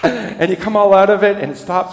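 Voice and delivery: usual speaking voice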